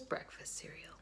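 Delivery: unvoiced whisper, muttered to yourself; whispered aside. A narrator's reading. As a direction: whisper it like a secret complaint.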